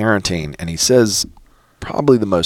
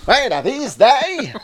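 very high, slow cartoon villain voice; imitating the Monarch; slower than usual. Host tries the cartoon villain's voice, very high and slow. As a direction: ham up the villain. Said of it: "I wish I could do his voice".

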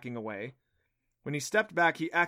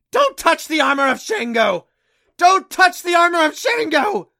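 very high, loud screeching parrot voice; piercing bird shriek, far above normal pitch; shrill parrot squawk; 17 dB louder than usual. A narrator's reading. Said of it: "a shrill voice called out".

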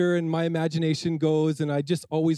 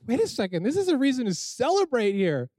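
higher, lightly mock-bewildered; puzzled youth pastor; 7 semitones higher than usual. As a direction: act out stopping the crying with disbelief. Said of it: "And I'm like".

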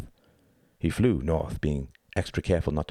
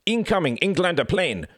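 high, urgent call of warning; wounded soldier's cry; 12 semitones higher, 3 dB louder than usual. A narrator's reading. Wounded German warns his side of the plane, urgent cry. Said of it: "cried the wounded German".

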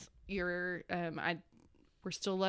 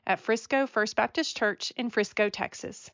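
podcast intro read; 6 dB louder than usual. Acted.

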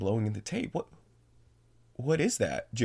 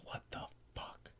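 barely audible, slow whisper; stunned, secret whisper to a companion; mouthed whisper; 20 dB quieter than usual. Acted. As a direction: mouth it with almost no voice. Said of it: "looks back at me and mouths".

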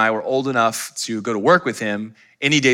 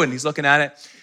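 ordinary talk, nothing marked

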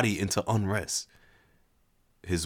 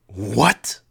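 shocked exclaim; 2 semitones higher, 6 dB louder than usual. Acted. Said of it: "he exclaimed".

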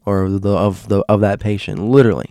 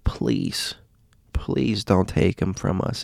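retold crying plea; 7 dB quieter, slower than usual. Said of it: "and cries in the name of Jesus Christ".

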